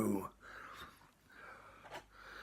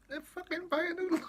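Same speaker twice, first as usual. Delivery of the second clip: squeaky exclamation; 3 dB louder than usual. Real, not acted. Shrieking about spicy noodles.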